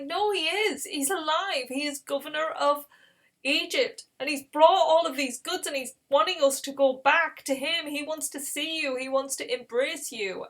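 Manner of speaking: high, eager insisting; excited insistence. Retold or imitated. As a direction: convince a doubter, excitedly. Brothers insist Joseph lives.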